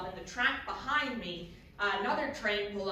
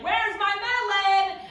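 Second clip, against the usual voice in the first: high, loud, drawn-out yell; shouting roommate; 10 semitones higher, 7 dB louder, slower than usual. Retold or imitated. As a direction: holler it through a closed door. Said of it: "she's shouting at me".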